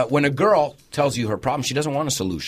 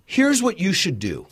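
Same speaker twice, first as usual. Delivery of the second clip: preachy advice; 5 semitones higher, slower than usual. Retold or imitated. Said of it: "another one that people say".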